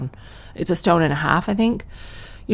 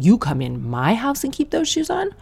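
outraged disbelief; 7 semitones higher than usual. Acted.

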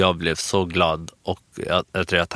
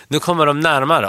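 mock alarm; 8 semitones higher, 5 dB louder than usual. Alarm as women approach.

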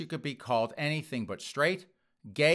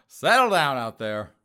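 settle down rant; 9 dB louder than usual. Real, not acted. Ranting at the critics.

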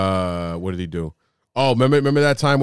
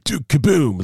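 Keanu impression; 5 semitones higher, slower than usual. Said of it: "he talks like this".

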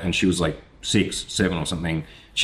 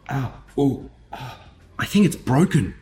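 pained; 7 semitones higher than usual. Retold or imitated. Just crashed bike, hurt hand.

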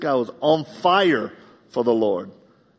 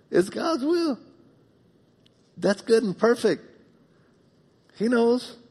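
preaching fervor; 12 semitones higher than usual. Acted. Preaching at full force.

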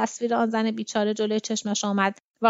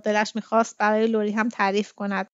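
usual speaking voice

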